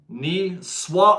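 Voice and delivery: "Ni swa" drawn out slowly with a clear s; corrected S pronunciation. Shown as a demonstration. Streamer tries out a viewer's correction, "Ni swa" with s, not sh. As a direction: sound out "Ni swa" slowly as nee-SWAH. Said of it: "it sounds like an S".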